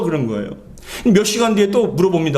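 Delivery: usual speaking voice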